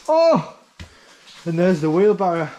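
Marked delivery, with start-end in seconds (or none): 0.1-0.6 surprised. Real, not acted. Spots the old wheelbarrow.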